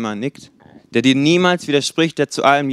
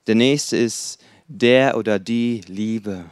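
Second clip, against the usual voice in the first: gentle voice; 3 semitones lower, 3 dB quieter, slower than usual. Acted. Introducing the gentle hypocrite type.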